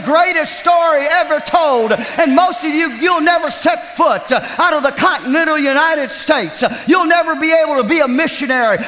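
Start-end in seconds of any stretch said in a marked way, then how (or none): 4.0-8.8 fast, urgent preaching. Real, not acted. Preacher urging viewers to support the network, fast. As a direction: drive the point home quickly.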